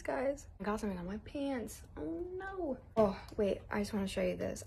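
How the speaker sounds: ordinary talk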